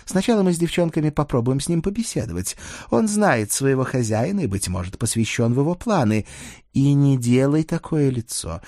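Dana, firm and teasing. Acted. Dana explains to her partner how she will interrogate, firmly.

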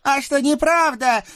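very high squeaky devil voice, pleading; a scrawny devil begging off; whiny little devil. Acted. Тощий чёрт спорит. (писклявый чёрт)

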